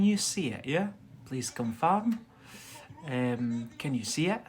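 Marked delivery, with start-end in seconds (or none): none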